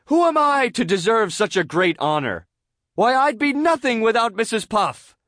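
high, loud, overblown acceptance speech; gushing SpongeBob speech. A narrator's reading. SpongeBob honoring teacher before classmates, gushing oration. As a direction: orate with grand, gushing humility. Character making a pompous speech.

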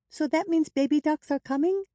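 put-on very high child's question; high-pitched, hopeful younger sister; excited little girl. A narrator's reading. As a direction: ask eagerly in a high little voice.

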